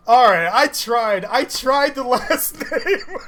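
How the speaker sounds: loud, drawn-out words breaking into laughter; real laughing defence; laughing protest. Real, not acted. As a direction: protest loudly, then crack up laughing. Laughing over botched name attempt.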